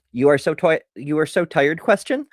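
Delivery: statement tone, then a sharply rising question; flat statement, tagged question. Retold or imitated. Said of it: "my oldest has done that".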